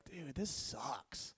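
soft, slow, glum mutter; dejected. Retold or imitated. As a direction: sag as you say it, defeated. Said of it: "I just remember being like".

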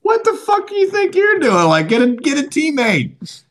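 high-pitched imitated rant; a hammed-up scolding; mock angry outburst, high. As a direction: blurt it out like you wish you had. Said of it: "we should have been like".

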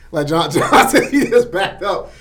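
normal talking voice

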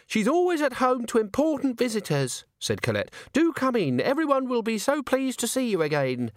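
high, sweet, welcoming voice; sugary young woman. A narrator's reading. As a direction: coo like a charming hostess. Voices Colette.

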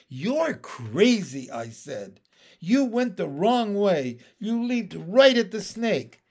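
loud, alarmed telling-off; exasperated scolding. Acted. Young frog loudly scolding Pin for leaping at the snake, exasperated. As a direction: scold the friend who nearly died.

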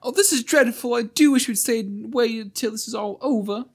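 put-on high, anxious child's voice; frightened child. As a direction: fret like a scared child.

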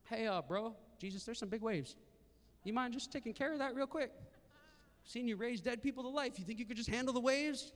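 casual mock request. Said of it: "nowhere do you see them going".

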